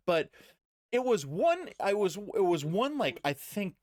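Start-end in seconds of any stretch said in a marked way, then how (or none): none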